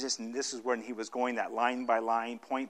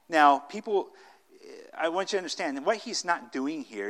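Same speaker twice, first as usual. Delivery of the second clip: ordinary delivery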